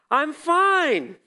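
high bright mock-cheery "I'm fine"; chirpy church answer. Telling Randy on the phone he's fine, mock-cheery. Pastor asked how he's doing.

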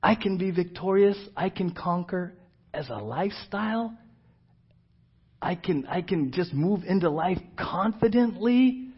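acted wonder. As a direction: ask it as if hardly daring to believe. Astonished believer hesitantly questioning.

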